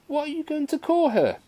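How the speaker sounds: very high-pitched motherly question; excited Mamma. A narrator's reading. Mother asking daughter to name the kitten, high excited voice. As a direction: ask in a high, put-on mother voice.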